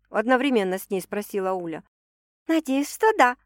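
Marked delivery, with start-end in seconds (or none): 2.5-3.4 cheerful. A narrator's reading. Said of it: "весело отозвалась хозяйка вечера".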